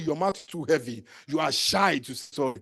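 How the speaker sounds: ordinary delivery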